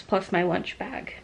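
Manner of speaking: usual speaking voice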